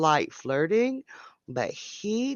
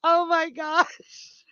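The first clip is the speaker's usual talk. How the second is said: laughing; 8 semitones higher, much slower than usual. Real, not acted. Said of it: "I've got tears coming out of my eyes".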